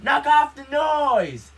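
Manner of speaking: furious high, drawn-out shout; loud, stretched-out angry yell; angry neighbor yelling. Retold or imitated. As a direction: holler it like a fed-up neighbor. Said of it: "the upstairs neighbor yelled".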